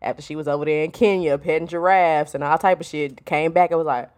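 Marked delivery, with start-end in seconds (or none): none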